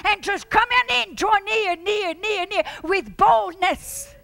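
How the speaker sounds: loud, rising exhortation; full-force preaching. Real, not acted. Preacher urging the congregation to come near, fervent. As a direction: urge them in with fire.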